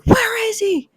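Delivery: high, urgent mock demand. Superman demanding the kid from government agents, high urgent demand. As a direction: confront them like an angry hero.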